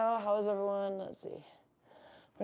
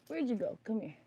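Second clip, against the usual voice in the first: ordinary talk, nothing marked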